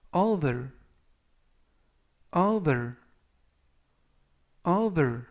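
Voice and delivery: over said clearly, three times; 또박또박 over 발음 시범; demo pronunciation. Shown as a demonstration. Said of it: "이라고 발음하시면 되겠습니다". (또렷하게 over 시범)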